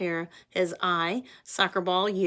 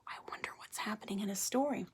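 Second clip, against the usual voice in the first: soft quick whisper, much softer; hushed fast aside to the class; 13 dB quieter, much faster than usual. Acted. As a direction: breathe it quickly like a secret thought.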